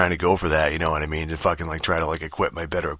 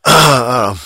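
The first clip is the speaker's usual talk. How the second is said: exasperated I don't know; 6 semitones higher, 11 dB louder, slower than usual. Real, not acted. Exasperated rant about confusing game.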